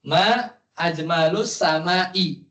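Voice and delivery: "ma ajmalus sama'i" read slowly, ending in u and i; daughter's reading. Shown as a demonstration. Teacher reads the daughter's wrong vowels slowly. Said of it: "Dia bacanya gimana?".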